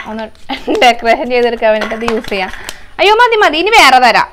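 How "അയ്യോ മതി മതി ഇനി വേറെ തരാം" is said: loud firm stop. Real, not acted. Stopping toddler with powder.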